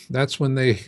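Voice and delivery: normal talking voice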